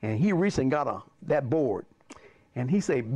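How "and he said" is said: ordinary delivery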